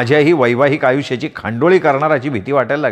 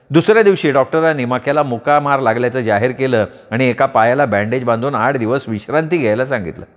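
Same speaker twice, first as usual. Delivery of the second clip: plain reading voice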